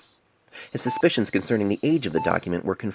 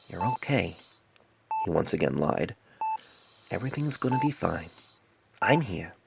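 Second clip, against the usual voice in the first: gentle reassurance; 4 semitones lower, 7 dB quieter than usual. Acted. Doctor soothing his patient.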